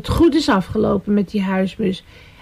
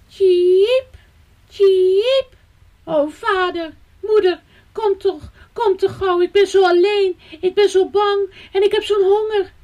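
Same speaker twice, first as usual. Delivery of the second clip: very high, plaintive chirping voice; frightened baby bird; 10 semitones higher than usual. A narrator's reading. Baby bird calls its parents from the gutter, frightened wail. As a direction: cry out like a lost little fledgling.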